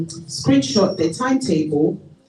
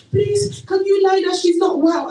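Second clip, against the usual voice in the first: pleading; 13 semitones higher, 3 dB louder than usual. Retold or imitated. Mother begging teacher to lie.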